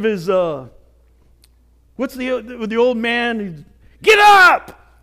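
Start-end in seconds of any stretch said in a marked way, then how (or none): 4.0-5.0 high, very loud yell; full-force imitation of a yelling trainer; screaming trainer. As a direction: bellow it like a boxing trainer urging a fallen fighter. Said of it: "The trainer's screaming and yelling at him".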